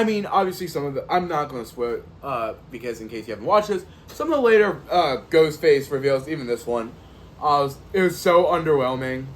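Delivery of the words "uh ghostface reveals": ordinary talk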